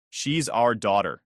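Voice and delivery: ordinary delivery, nothing marked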